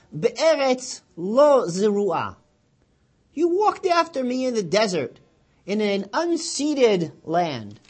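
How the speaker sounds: slow, loud, high intoned verse; solemn verse recitation. Acted. Preacher proclaiming the prophet's words slowly.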